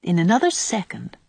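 plain reading voice

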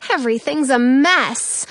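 ordinary delivery

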